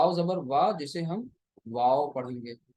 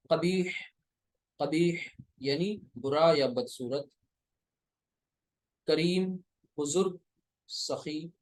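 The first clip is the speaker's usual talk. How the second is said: usual speaking voice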